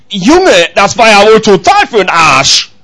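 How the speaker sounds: loud, drawn-out mock scolding; energetic rebuke. God giving Patrick straight talk, energetic rebuke. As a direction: punch out a blunt dressing-down. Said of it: "wenn Gott mal ein bisschen energischer wird".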